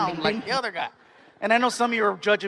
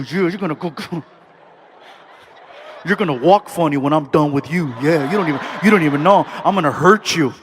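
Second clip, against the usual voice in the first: lower, loud menacing bedroom growl; freaky voice; 3 semitones lower, 6 dB louder, faster than usual. Retold or imitated. Lover promising a wild night, low mock-menacing voice. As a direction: threaten it with a leer. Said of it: "We have our freaky voice".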